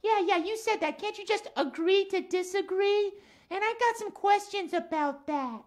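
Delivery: lilting cat character voice; Max the cat. Acted. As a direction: play a chatty cat puppet for children. Puppet cat Max talks.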